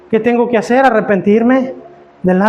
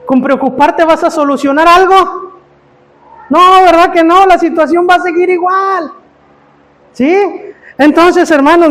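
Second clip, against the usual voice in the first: louder, higher preacher's call; preaching at full force; 5 semitones higher, 3 dB louder than usual. Acted. Pastor challenging the brothers about worry, preaching hard. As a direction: challenge the listeners head-on.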